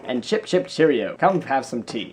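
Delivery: hammed-up mock British; badly faked British accent, playfully over the top; loopy British accent. Shown as a demonstration. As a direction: try a silly, crazy-sounding British accent. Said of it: "I can try a British accent".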